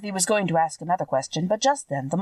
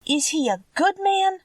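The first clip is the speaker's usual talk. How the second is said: anxious question; 13 semitones higher than usual. A narrator's reading. Dorothy asking the witch whether Oz is good, anxious and high. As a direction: ask it hoping hard for a yes, pitch leaping up. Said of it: "inquired the girl anxiously".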